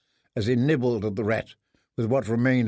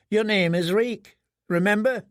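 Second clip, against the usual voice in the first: lightly voiced taunting boy; 7 semitones higher than usual. Boy telling prisoner his name.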